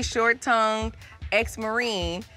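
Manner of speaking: usual speaking voice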